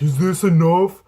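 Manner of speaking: loud, slow naive question; innocent kid. Retold or imitated. Kid showing handfuls of weed, loud slow naive ask. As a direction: ask it wide-eyed and eager.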